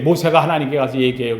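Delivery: normal talking voice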